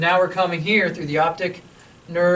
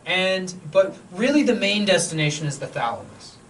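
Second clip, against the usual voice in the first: usual speaking voice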